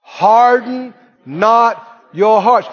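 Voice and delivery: slow, loud, every word weighted; slow emphatic recitation. Shown as a demonstration. Preacher leading the verse: slow, loud, drawn out. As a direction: lead the church through the verse slowly. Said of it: "Say it again".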